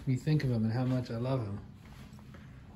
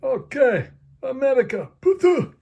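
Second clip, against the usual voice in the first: high, loud taunting heel; Iranian wrestler; 9 semitones higher, 6 dB louder than usual. Acted. The Iranian toy wrestler jeering America, high and loud. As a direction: spit out the insult at America. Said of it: "I am from Iran".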